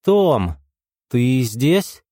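plain reading voice

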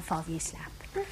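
put-on soft whisper to a sleepy child. Nurse whispering to David as he drifts off. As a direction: hush him gently toward sleep.

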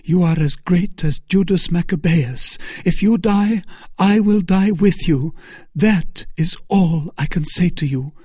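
high, loud, impassioned Milady; fervent Milady. Acted. Milady vowing to die with Felton, high and fervent. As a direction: declare devotion, pitched high and loud. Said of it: "cried she".

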